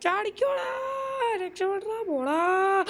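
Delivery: high frantic yells at the game character; panicked shouting. Real, not acted. Gamer panicking as her character nearly dies, frantic high shouting. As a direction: scream the character to jump and escape.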